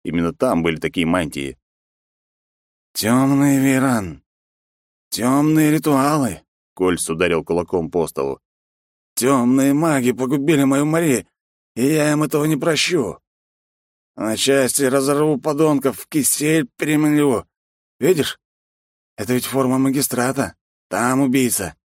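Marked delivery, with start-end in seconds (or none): 2.9-6.4 put-on slow ominous drunk. Drunk man with clue. 9.1-21.8 put-on drunken vengeful rage. Drunk man vows revenge.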